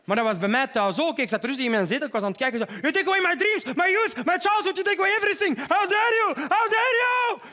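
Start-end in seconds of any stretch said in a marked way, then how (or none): 2.8-7.4 very high, hammed-up furious shouting; Greta Thunberg. Greta on TV at home, mock furious shouting. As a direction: shriek it, stretching the final question. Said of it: "toen ik haar zo zag roepen".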